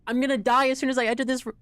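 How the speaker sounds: high, loud and fast panic; panicked rush. Real, not acted. Streamer panicking before a deadly room, high and fast. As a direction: blurt it out in a rush of dread.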